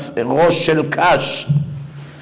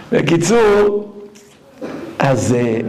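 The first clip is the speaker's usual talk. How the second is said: ordinary talk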